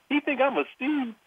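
high, squeaky cartoon donkey voice; Donkey impression. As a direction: chirp it like the talking donkey. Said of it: "can you do Donkey's impression".

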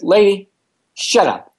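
snapping back at the league organizer; angry retort. Retold or imitated. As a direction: put the lady in her place.

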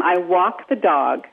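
New York vowels, slow and drawn out; New York accent. Shown as a demonstration. A coach demonstrating the New York accent slowly. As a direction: sound it out as a New Yorker would. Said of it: "So you go from".

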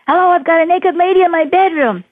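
high, loud panicked phone call; mock panic. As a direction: imitate a frightened man calling for help. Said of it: "I was scared to death".

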